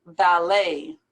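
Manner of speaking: "Ballet" as "bal-LAY", silent T; A-sound ending. Shown as a demonstration. Teacher matching it to buffet, AY ending. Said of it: "like an A or an A Y sound".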